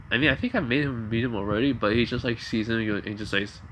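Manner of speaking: ordinary delivery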